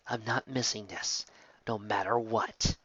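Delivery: soft, slow, resolved vow; quiet determination. Acted. Determined vow, much softer.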